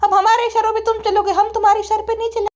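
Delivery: high, insistent daughter voice; whiny daughter. Acted. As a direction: play the daughter pleading in a high pitch. (ज़िद्दी बेटी की ऊँची आवाज़)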